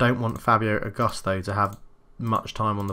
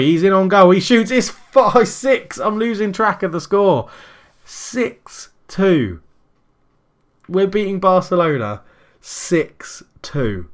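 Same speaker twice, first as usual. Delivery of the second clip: loud, much higher goal call, slowing at the end; goal frenzy; 8 semitones higher, 7 dB louder, slower than usual. Real, not acted. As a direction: scream the goal then savour the score. Another goal scored.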